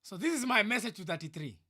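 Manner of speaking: ordinary talk, nothing marked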